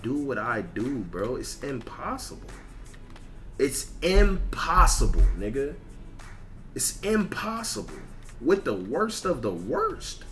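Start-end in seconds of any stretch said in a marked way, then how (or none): none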